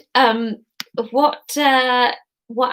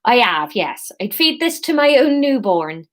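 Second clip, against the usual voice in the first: put-on Somerset accent; 3 semitones higher than usual. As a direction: keep the Somerset burr going. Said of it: "this is gonna be Somerset".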